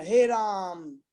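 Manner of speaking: "हे राम" as a slow, stretched address; drawn-out vocative. Shown as a demonstration. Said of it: "संबोधन में".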